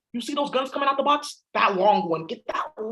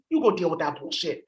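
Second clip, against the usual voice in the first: usual speaking voice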